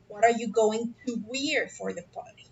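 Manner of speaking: "party" dropped at the end; falling question intonation. Shown as a demonstration. Teacher models an open question, voice falling towards "party". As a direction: bring the pitch down by "party". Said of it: "la entonación de nuestras preguntas baja".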